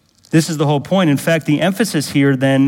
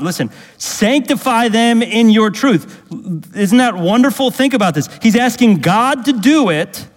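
high, fervent sermon delivery; raised-pitch preacher in full flow; impassioned preaching; 9 semitones higher, 4 dB louder than usual. Real, not acted. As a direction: press it home to the congregation with excitement. Preaches Jesus' prayer with rising fervour.

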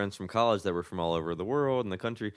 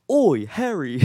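high loud Cockney "Oi, Harry"; hammed-up British accent, higher and louder; jokey Cockney accent; mock British accent; 8 semitones higher, 5 dB louder, slower than usual. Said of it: "someone asks me to do, like, a British accent".

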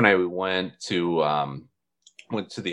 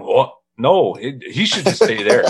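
louder, higher flustered protest; mock backtracking; 6 semitones higher, 7 dB louder than usual. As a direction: backtrack loudly as the caught-out fans. Mimicking fans backtracking on trade demand.

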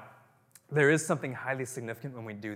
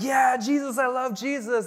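mock gushing believer; 12 semitones higher, 8 dB louder than usual. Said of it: "We're like".

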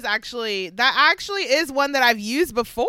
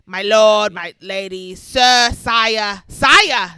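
put-on old English accent; 8 dB louder, much slower than usual. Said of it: "old English accent".

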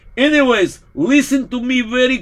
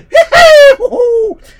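loud, high crazed laughing shout; maniacal laughing shriek; 13 semitones higher, 9 dB louder than usual. Acted. Priest gone mad, loud maniacal laughter.

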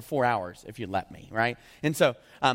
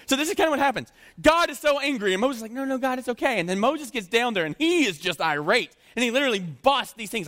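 preaching build; 10 semitones higher, 4 dB louder than usual. Acted.

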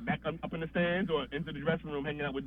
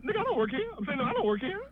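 high-pitched defensive protest; retold protest, pitched well up; mock indignant; 9 semitones higher, faster than usual. Writer answering the stage manager, mock indignant.

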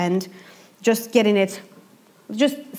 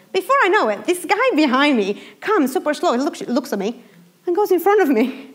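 ordinary delivery

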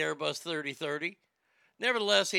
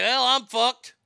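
panicked pilot; 4 semitones higher, 8 dB louder, slower than usual. Retold or imitated. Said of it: "I'm like".

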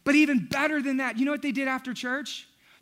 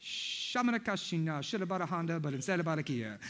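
mock speaking in tongues; 6 semitones lower, 8 dB quieter than usual. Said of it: "she let it rip".

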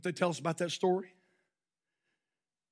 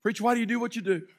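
normal talking voice

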